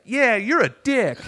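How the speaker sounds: loud, high, drawn-out imitated heckle; heckler's yell. Audience member to comic on stage, yelling. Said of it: "someone yelled out".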